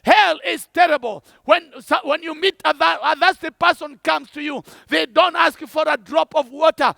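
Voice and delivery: ordinary talk, nothing marked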